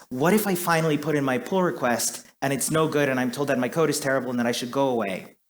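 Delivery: normal talking voice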